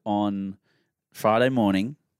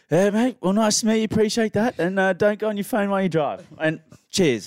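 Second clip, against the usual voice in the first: put-on high, louder chirpy old bloke voice; pitched-up mock bloke saying cheers to the driver; imitating the old bloke; 10 semitones higher, 4 dB louder than usual. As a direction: do the hitchhiker's cheery goodbye, pitched well up. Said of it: "he's like".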